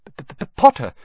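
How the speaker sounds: nervous stammer on P; a nervous teacher stammering out the name. Acted. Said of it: "stammered Professor Quirrell".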